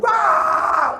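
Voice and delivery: sudden high mock shout of alarm; alarmed cry. Man watching the truck roll away gives an alarmed high cry. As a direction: yelp as the truck starts to roll.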